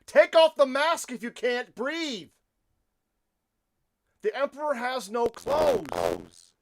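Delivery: slow, loud and high, hammering each word; a slow indignant outburst from a commentator; loud rant. Real, not acted. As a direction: shout it at the crowd as proof. Ranting at mask-wearing protesters.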